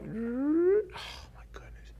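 high squeal fading into a whisper; rising 'oh', then awed whisper; acted amazement. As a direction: gasp it out, ending nearly voiceless. King's arm suddenly restored.

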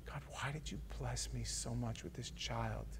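very soft, low awe; grateful whisper-soft prayer. Acted. Parent thanking God beside a sleeping child, very soft. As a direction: breathe it over a sleeping child.

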